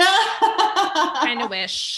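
slow, drawn out through laughter; giggly slow aside; laughing. Real, not acted. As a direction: repeat it while cracking up. Laughing at her own joke.

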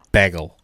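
"Bagel" with a short A, like "bag-el"; teasing copy, "Bagel" rhyming with haggle; mimicked bagel pronunciation. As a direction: imitate his "Bagel" as "BAG-ul". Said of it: "I love the way this guy says bagel".

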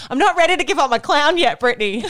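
high-pitched, put-on protest; mock pleading. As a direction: protest like a needy sister, joking. Sister mock-pleads against the boyfriend.

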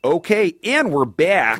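exaggerated Minnesota accent. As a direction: absurd Minnesota radio voice. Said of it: "That was like a Minnesota accent veering into absurdity".

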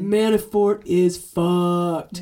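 slow, dragged-out delivery; slow emphatic. Shown as a demonstration. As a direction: stretch each word out. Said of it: "Can you put an echo on that?".

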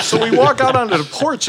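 laughing as he tells it; laughing while talking. Real, not acted. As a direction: push through your own laugh.

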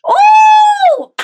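high, loud delighted whoop; excited shout. Real, not acted. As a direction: cheer out the oh. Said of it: "That was amazing".

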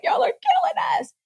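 high, squeaky mock cry of the good army; pleading good bacteria. Good gut bacteria begging the body, high mock plea. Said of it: "the good people are like".

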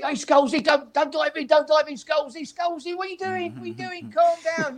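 high, strained voice calling a player; calling to Scholes. Retold or imitated. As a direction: squeak it out to calm a player. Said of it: "I said".

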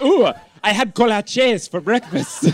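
exaggerated Spanish accent on kolaches. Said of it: "with a Spanish accent".

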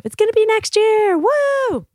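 high-pitched, excited whoop; gleeful, hopeful outburst; upbeat cheer. Real, not acted. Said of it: "I like to keep it positive".

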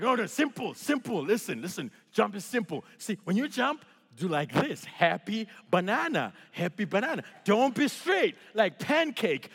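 put-on choppy foreign-accented coaching; hammed-up accented motivational speaker, short phrases; broken-English pep talk. Instructor coaching a scared first-time jumper, in broken-English accent. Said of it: "So he tries motivational speaking".

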